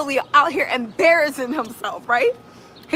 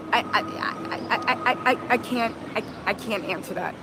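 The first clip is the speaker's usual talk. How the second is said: quiet, low, stumbling stammer; mock a cornered witness who can't get words out; 5 semitones lower, 5 dB quieter than usual. Host imitates the flustered witness on the stand, quiet stammer. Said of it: "turned into Mia and was like".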